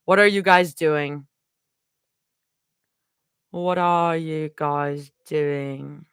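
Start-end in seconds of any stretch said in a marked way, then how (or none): 3.5-6.1 slow, lower drawl; slow put-on vocal stim; drawn-out stim. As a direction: stretch every word out in a put-on voice. Repeats line as vocal stim.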